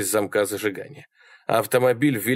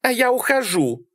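high female defiant call; high-pitched defiant cry; shout over shoulder. A narrator's reading. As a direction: toss it back while leaving. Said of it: "крикнула она через плечо".

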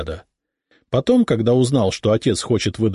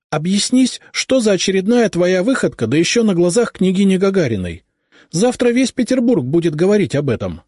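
high, louder irritated reproach; 11 semitones higher, 4 dB louder than usual. A narrator's reading. Mother reproaching her son over his escapade, irritated. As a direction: scold him for the scandal. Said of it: "с раздражением сказала она".

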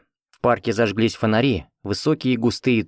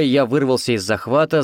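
plain reading voice

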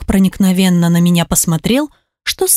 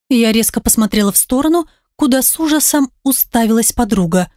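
ordinary delivery, nothing marked

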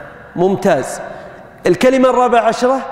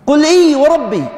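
إيه said high and ringing, falling at the end; stress on إيه; 5 semitones higher than usual. Shown as a demonstration. Teacher reciting the verse to his class, stressing إيه. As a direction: lean hard on إيه before وربي. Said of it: "في القرآن".

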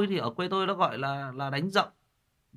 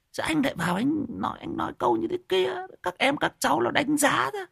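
exasperated high scolding; 6 semitones higher than usual. Real, not acted.

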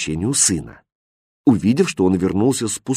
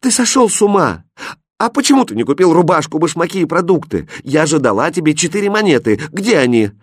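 high, raised-voice, frantic questioning; desperate scolding; 6 semitones higher than usual. A narrator's reading. Mother demanding her four coins back, desperate cry. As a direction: scold him in rising desperation. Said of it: "отчаянно крикнула Фатьма".

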